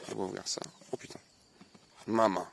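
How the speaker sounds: ordinary delivery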